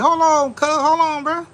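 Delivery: indignant pushback. Retold or imitated. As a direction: brush off a nosy salesman. Indignant retort to the salesman.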